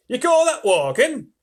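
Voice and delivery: mock him loudly; scornful cry. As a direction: centipede scornfully crying at the earthworm. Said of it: "cried the centipede".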